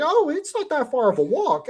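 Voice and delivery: high, put-on voice of reluctant visitors; imitated hesitant tourists. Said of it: "they were hesitant".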